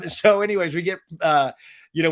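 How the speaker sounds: ordinary talk, nothing marked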